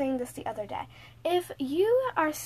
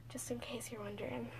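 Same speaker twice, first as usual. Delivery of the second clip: breathy whispered aside; whispering; 3 semitones lower, 17 dB quieter than usual. Real, not acted. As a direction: whisper it to the viewers. Aside to viewers.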